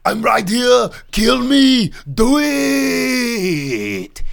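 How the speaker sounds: loud, high, raw battle yell; defiant shout. Retold or imitated. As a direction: scream a dare at the monster. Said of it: "being like".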